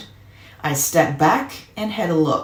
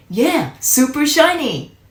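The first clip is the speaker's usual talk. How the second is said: excited; 11 semitones higher, 4 dB louder than usual. Acted. Boy gushing about the new bike.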